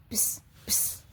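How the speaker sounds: soft unvoiced buzz of a bee. Shown as a demonstration. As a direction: breathe out a bee's buzz. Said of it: "बीज की sound करती है". (मधुमक्खी की धीमी भिनभिनाहट)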